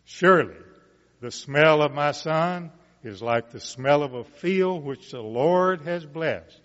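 measured, rising Bible reading voice; scripture reading. Acted. Preacher reading Genesis aloud to the congregation, solemn and building. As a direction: read the blessing to the congregation, building.

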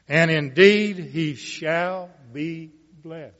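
measured, rising Bible reading voice; scripture reading. Acted. Preacher reading Genesis aloud to the congregation, solemn and building. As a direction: read the blessing to the congregation, building. Reads Esau's return aloud.

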